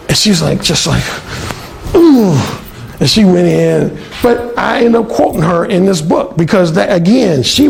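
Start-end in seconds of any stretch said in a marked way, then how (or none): 2.0-2.4 louder, higher offended mock ooh; huffy ooh. Alan imitating the roommate's offended reaction. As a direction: let out a put-out ooh.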